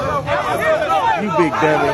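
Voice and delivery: mock scold. Real, not acted. Scolding man in the clip.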